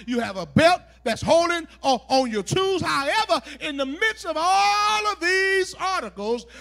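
loud, driving sermon delivery; forceful preaching. Acted. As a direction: preach the list out with rising force.

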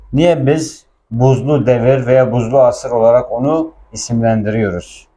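ordinary talk

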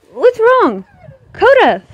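high, loud, alarmed call; calling the dog. Real, not acted. Owner calls to her dog Coda, high worried shout. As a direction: call out sharply to a dog across the yard.

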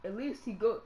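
ordinary talk, nothing marked

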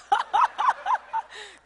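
high, loud shrieking burst of laughter. Real, not acted. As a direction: let a squealing laugh fly. Mock joy at pricey jersey.

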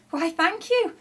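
high-pitched, flattered thanks; bashful pleasure. A narrator's reading. Shifty thanks the admiring neighbours, blushing. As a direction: accept the praise with a blush. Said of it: "blushed Shifty".

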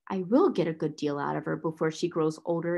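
low-pitched, determined retort; grim exclamation. A narrator's reading. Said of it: "exclaimed Miss Minchin".

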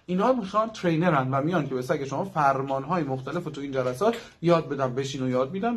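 ordinary talk

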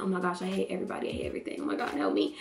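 hushed, rushed fake-crying whimper; imitated tearful near-whisper; mock crying breakdown. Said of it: "He started crying".

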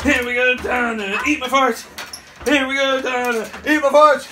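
mock taunt rising to a loud call; taunting call building. Calling out to the neighbour.

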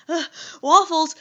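loud, high whiny groan; mock whine. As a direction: whine it like a dramatic youtuber. Quoting Toby's vlog line.

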